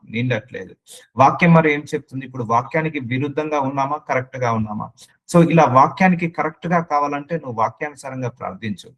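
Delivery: ordinary talk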